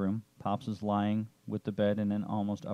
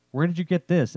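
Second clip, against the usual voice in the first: surprised; 8 semitones higher, 8 dB louder than usual. A narrator's reading. Amazed at the stolen car.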